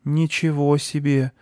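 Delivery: high, slow, awed exclamation; boy taken aback by the tale; impressed. A narrator's reading. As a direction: stretch it out in sympathetic amazement. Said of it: "проникся мальчик".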